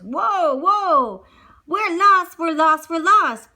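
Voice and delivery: loud frantic yelling, lost in the woods; panicked cries. Acted. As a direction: shout it in a panic. Maurice panics as horse bolts.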